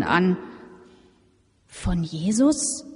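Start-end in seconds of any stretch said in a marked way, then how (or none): 1.7-2.9 halting, slow astonished stammer. A narrator's reading. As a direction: stammer it out in disbelief. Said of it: "stammelte sie".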